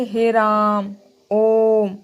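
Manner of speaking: हे राम and ओम stretched out slowly and loud; prolonged vowel demo; prolonged call. Shown as a demonstration. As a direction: call it out long, like calling someone from afar. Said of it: "पुकारने, जोर से गाने".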